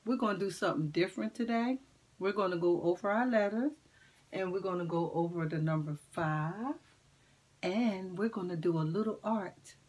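ordinary talk, nothing marked

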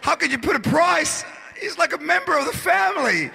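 hammed-up sobbing woman pleading; mock crying plea. Mother wailing over the family dog, mock crying. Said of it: "She was crying".

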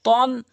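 তন with rounded mouth, heavy t and dark vowel. Shown as a demonstration. Teacher shows two letters, round heavy তন. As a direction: round the lips on a slow তন. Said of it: "তটি মুখ গোল হবে".

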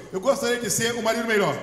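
loud, quick call for the church to repeat; loud lead line. Shown as a demonstration. Pastor leading husbands in a loud repeat-after-me. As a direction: belt it out for them to echo. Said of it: "Agora fala assim".

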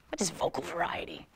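hushed question; whisper. Acted. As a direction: ask it in a whisper.

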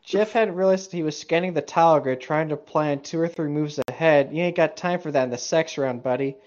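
ordinary talk, nothing marked

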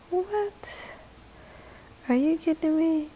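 high-pitched disbelief; incredulous. Real, not acted. Streamer reacting to viewer buying another console, incredulous. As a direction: exclaim as if you can't believe it.

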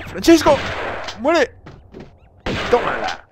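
high, loud attack cry; battle shout. Real, not acted. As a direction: shout it at the enemy you are attacking. Calling out while attacking in game. (grito de ataque)

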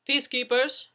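police officer calling out at the door, loud. Acted. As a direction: announce yourself through a closed door. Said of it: "someone called".

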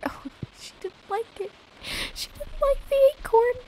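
very high, squeaky through laughter; laughing squeal. Real, not acted. Gift rejected by Maru, laughing squeal. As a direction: squeal helplessly while laughing.

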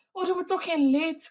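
frightened cry. A narrator's reading. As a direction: beg him with a frightened cry. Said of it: "kreet ze".

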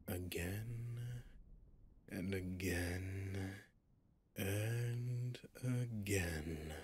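taunting, lingering singsong of endlessness; drawn-out repetition. Acted. Demon threatens endless repeated tale.

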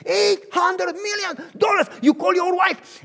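high, loud, slow shouted sum; high slow ecstatic shout. Acted. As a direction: shout it to the rafters.

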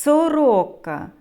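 very long "с" before the rest of "Сорока"; drawn-out S. Shown as a demonstration. Teacher repeating for children, "Сорока" with very long initial "с". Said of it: "щоб добре було чути звуки".

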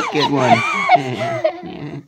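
said through a burst of laughter; laughing. Acted. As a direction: laugh out the words. Laughing outburst.

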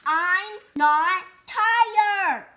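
loud, slow, drawn-out insistence; loud protest. Acted. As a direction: stretch out the denial, indignant. Pigeon insists.

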